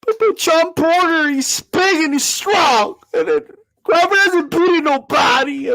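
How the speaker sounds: high stammering fan objection; hammed-up whiny critic; mock doubter. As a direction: mimic the flustered Porter backer.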